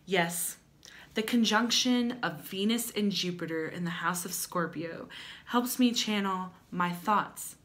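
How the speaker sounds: ordinary talk, nothing marked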